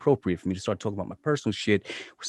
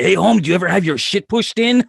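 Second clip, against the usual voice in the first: higher, louder Los Angeles gang-thug voice; Training Day gangster impression; 9 semitones higher, 7 dB louder than usual. Host quoting the Training Day villain to his guest, hammed-up thug voice. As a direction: ham up the streetwise Training Day heavy. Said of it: "You sound like the fucking dude from Training Day".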